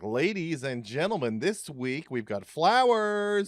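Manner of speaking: flamboyant store announcer. Retold or imitated. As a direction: stretch every word like a showman on the store PA.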